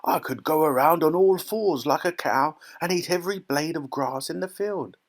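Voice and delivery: gleeful grandpa character voice; delighted grandpa. A narrator's reading. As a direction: grin through it as a thrilled grandfather. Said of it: "grinning with delight".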